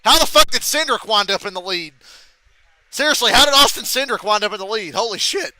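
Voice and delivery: loud, disbelieving question; stunned, demanding how it happened; incredulous. Real, not acted. Streamer asks how Cindric took the lead, loud disbelief.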